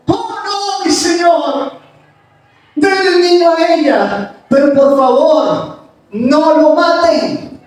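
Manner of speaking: high, pleading cry that slows down at the end; desperate cry. Acted. True mother begging King Solomon to spare her baby, high desperate cry. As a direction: beg the king to spare the child. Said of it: "gritó".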